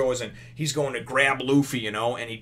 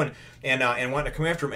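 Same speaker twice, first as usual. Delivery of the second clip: normal talking voice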